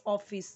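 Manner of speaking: ऑफिस with a rounded o; correct pronunciation. Shown as a demonstration. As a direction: say ऑफिस as "off-iss". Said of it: "कई सारे लोग इसे".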